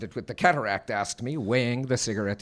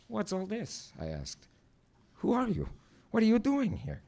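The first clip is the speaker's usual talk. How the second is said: high, thin questions to intruders; startled questioning; 10 semitones higher, 4 dB quieter than usual. Acted. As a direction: pitch up in bewildered alarm. Startled narrator confronts intruders.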